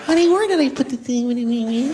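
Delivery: put-on high, whining baby talk; whiny greedy voice. Boyfriend nagging his girlfriend, high whining voice. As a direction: whine the question up high. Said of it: "that annoying, greedy thing".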